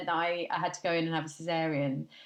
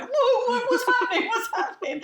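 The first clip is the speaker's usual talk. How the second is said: much higher, loud, alarmed and laughing; startled husband; 8 dB louder than usual. Retold or imitated. Husband leaping across the room at her moving stomach, alarmed. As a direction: yelp it like he just saw an alien. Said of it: "and went".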